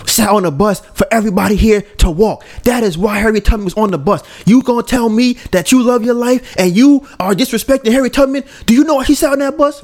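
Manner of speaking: high, impassioned motivational speaker; hammed-up motivational speech, pitched well up; Eric Thomas. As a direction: preach it with rising intensity. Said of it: "he's a motivational speaker... he would go".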